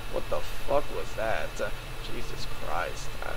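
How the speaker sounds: very low and soft, under the breath; stunned muttering. Acted. Reacting to own silly outburst, quiet muttering. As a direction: drop to a bewildered, deflated mumble.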